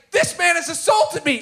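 high, mock outraged cry of a wronged man; mock indignant yell. Guard accusing the comedian at the station, mock indignant yell. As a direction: squeal it like a man accusing you. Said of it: "he started saying".

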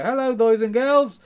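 high, loud, slow dummy greeting; ventriloquist's dummy. Acted. Dummy finally greets the boys and girls, high slow voice. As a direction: draw out a loud, high puppet hello. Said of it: "then it would go".

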